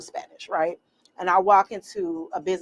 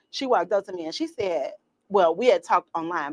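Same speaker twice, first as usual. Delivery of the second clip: ordinary talk, nothing marked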